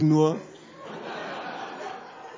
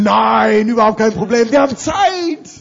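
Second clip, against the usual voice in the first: mock-eager insistence; 3 semitones higher, 7 dB louder than usual. Urging Markus to keep eating.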